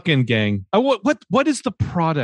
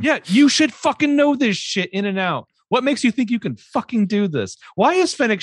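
high-pitched, incredulous exasperation; exasperated rant; 9 semitones higher than usual. Real, not acted. Podcaster rants about clueless crime boss, exasperated. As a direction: rant in disbelief at someone clueless.

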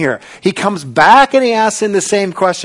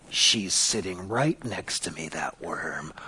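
hushed aside, fading to a whisper; teasing whispered jab; mock whisper; 11 semitones lower, 13 dB quieter, slower than usual. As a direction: whisper it to your neighbour as a joke. Congregant mocking neighbour aside.

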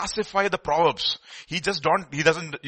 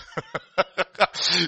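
laugh; 3 semitones higher than usual. Real, not acted.

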